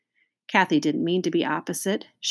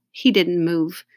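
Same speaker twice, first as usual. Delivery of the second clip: ordinary delivery, nothing marked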